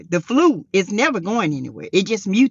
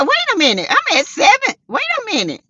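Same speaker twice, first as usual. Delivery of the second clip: surprised; 12 semitones higher than usual. Retold or imitated. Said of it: "I said".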